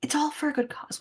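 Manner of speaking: sheepish whispered excuse; whispering. Acted. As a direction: whisper it like a guilty aside. Justifying human sacrifice sheepishly.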